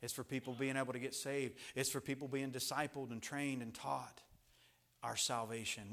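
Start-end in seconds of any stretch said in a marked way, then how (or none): none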